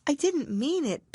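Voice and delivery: put-on anxious girl pleading. A narrator's reading. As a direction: beg forgiveness. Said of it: "pleaded poor Alice".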